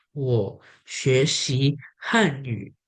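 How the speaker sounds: very slow, word by word; spaced out with pauses for learners; example sentence. Shown as a demonstration. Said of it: "saya berikan contoh".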